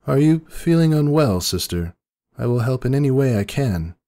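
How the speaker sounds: a high, fretful princess voice; Luna's worried voice. A narrator's reading. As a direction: ask with anxious care. Luna voiced in story.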